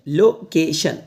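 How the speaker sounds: Location split as "lo - ke - shan"; broken into syllables. Shown as a demonstration. Teacher showing learners how to read location, syllable by syllable. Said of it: "ऐसे तोड़ के पढ़".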